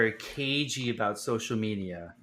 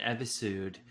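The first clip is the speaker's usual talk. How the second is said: episode said as "epi-sood" in a Canadian accent; Canadian accent, episode ending in "sood"; 3 semitones lower, 9 dB quieter than usual. Real, not acted. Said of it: "the strangest Canadian accent I've ever heard".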